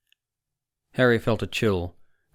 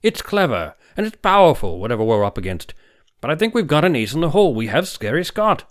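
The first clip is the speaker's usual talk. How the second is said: much higher and louder, urgent; heated intensity; 7 semitones higher, 8 dB louder than usual. Acted. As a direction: press the point fiercely. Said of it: "eyes flashed angrily".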